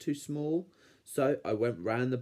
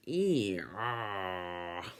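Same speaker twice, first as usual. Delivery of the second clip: creaking door; 3 semitones lower, 3 dB quieter than usual. A narrator's reading. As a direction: imitate a long creak that sinks away. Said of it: "The door went".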